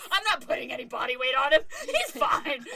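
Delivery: frazzled mom snapping at her mother; insane Brooklyn mode. Retold or imitated. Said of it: "I've become insane Brooklyn".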